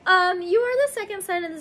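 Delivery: high, teary okay; choked-up okay. Real, not acted. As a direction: pull yourself together, still teary. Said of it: "I just got done tearing up".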